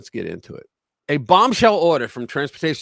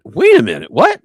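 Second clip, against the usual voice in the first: sudden much higher, louder disbelieving what; incredulous; 12 semitones higher, 5 dB louder than usual. Retold or imitated. Reacting to the CDL rules, higher and louder in disbelief. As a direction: snap into shocked disbelief. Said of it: "I'm like".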